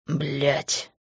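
a pained groan under his breath; low groaned curse. A narrator's reading. Man groans in despair, low and soft. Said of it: "простонал он".

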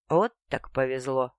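dry sarcastic remark. A narrator's reading. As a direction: drip with sarcasm. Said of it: "саркастично вставила".

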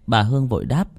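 plain reading voice

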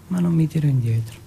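ordinary talk

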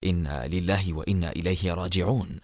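low, soft murmured condolence; hushed, low-pitched resignation; sorrowful murmur. A narrator's reading. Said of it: "وهز المقدم راشد رأسه مغمغما".

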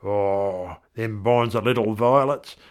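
put-on broad old-time Australian voice, lilting and unhurried; vernacular verse recital. Husband remembering his wife Doreen, broad Australian verse recital. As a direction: recite as a rough-spoken, fond husband.

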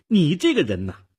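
put-on high, loud scolding girl; stern accusation. A narrator's reading. Girl scolds Nick for doubting her, accusing sharply. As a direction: rebuke him sharply. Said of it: "少女厉声指责着".

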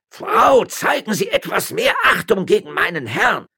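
high, indignant shout; outraged servant's yell; yelling at her. A narrator's reading. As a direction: scold loudly in defence of your master. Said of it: "die Müllerin anschrie".

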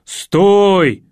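loud, drawn-out shout to halt; крик. Acted. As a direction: yell after a runaway animal. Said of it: "закричал". (громко крикнуть)

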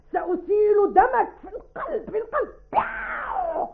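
high stage cries for help and vengeance; violent, clear delivery. Shown as a demonstration. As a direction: shriek through a hammed-up murder scene. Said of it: "هكذا".

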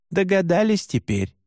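plain reading voice

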